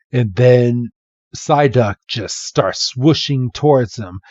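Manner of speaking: ordinary delivery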